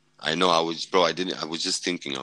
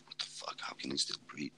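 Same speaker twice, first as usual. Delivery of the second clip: barely voiced, hushed disbelief; stunned whisper; 11 dB quieter than usual. Medic staring at the dying man, whispering in disbelief. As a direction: whisper it like you can't believe your eyes.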